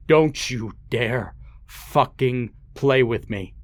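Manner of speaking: menacing, drawn-out threat. Acted. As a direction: lean in and spit each word slowly. Father repeats, angrier and slower.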